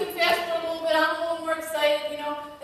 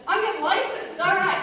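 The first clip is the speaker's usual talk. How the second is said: louder, higher determined declaration; eager vow; 4 semitones higher, 5 dB louder than usual. Retold or imitated. Wife vowing to her husband she'll get licensed, fired up. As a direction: announce it like a rallying cry.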